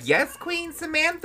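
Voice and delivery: high squeaky cat voice. Pretend.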